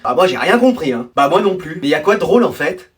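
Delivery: ordinary delivery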